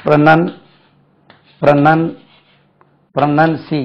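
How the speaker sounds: ப்ரொனன்சி built up in parts with long pauses; syllable breakdown. Shown as a demonstration. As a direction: stop after "pro-nun", then ப்ரொனன்சி. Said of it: "இங்க தான் நிறுத்தணுங்க".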